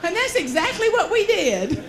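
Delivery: real laughter breaking through the punchline; laughing. Real, not acted.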